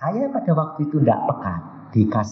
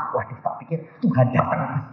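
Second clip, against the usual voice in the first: ordinary talk, nothing marked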